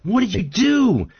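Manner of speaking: frog's slow, high-pitched outrage; angry drowning frog. Acted. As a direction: berate the scorpion as you sink. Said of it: "and he says".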